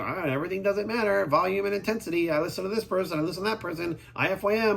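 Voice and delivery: mild mock of people who listen to everyone; mocking careless lifters. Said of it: "They just thought".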